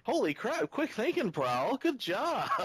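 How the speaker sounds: high, hammed-up old master voice; mock Yoketron. A podcaster jokingly voices Yoketron thanking Prowl, hammed-up and high. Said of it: "Yoketron didn't get up and say".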